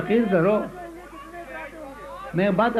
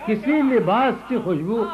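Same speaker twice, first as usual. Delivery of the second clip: poem recital; 2 semitones higher than usual. Acted. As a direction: savour each line for the audience. Recites third qita.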